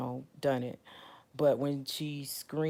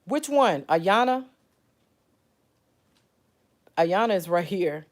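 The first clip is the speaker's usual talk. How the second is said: raised, higher voice to someone nearby; calling out; 5 semitones higher, 9 dB louder, slower than usual. Real, not acted. Asking someone nearby about Ayana, calling out. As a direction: call across the room, loud and high.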